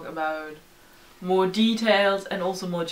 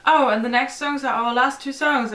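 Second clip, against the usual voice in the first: higher, louder announcing voice; band announcing last songs; 5 semitones higher, 5 dB louder than usual. Retold or imitated. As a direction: lively quote of the band addressing the crowd. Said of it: "they were like".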